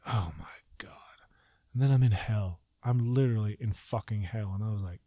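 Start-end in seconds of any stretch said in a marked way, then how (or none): 0.1-1.2 slow hushed whisper; whispered dread. Retold or imitated. Realizing he is in hell, a slow whisper. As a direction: whisper it in awe and fear. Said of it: "I'm like".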